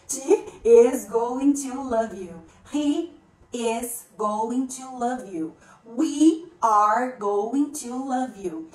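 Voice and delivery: slow, separated words with firm subjects; slow modelling. Shown as a demonstration. Teacher running through she, he and we for learners, slow and deliberate. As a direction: model each form slowly for learners.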